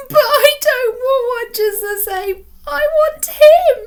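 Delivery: put-on high, loud, slow wailing child; crying girl refusing a replacement; sobbing protest. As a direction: drag it out in a tearful wail.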